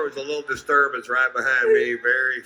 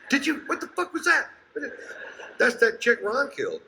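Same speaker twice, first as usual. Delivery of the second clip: spooked passerby; 13 semitones higher than usual. Pretend. Startled hikers hear the ghost.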